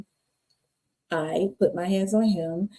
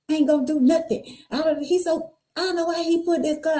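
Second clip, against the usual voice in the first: higher, brushing off her mother's fear; retold defiance; 9 semitones higher than usual. Her own retort, voice raised.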